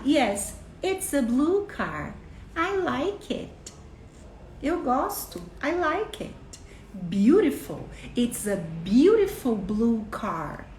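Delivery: put-on slow, clear English for a toddler; modeling to a child. Shown as a demonstration. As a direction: show the child each word, slowly. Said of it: "Você pode falar".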